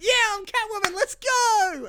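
very high squeaky excited voice; high-pitched giddy impression; excited Anne Hathaway. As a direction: squeal it like she's thrilled to be Catwoman. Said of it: "And she went".

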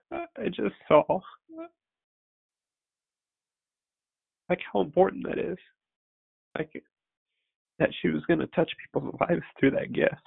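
fighting back tears as you tell it; emotional, near-crying recollection; tearful awe. Real, not acted. Tearful vision of daughter.

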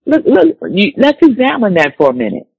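normal talking voice

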